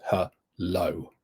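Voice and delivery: hello split into "huh" and "low"; hello in two separate beats, unstressed huh, then low; broken into syllables. Shown as a demonstration. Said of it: "Huh or low".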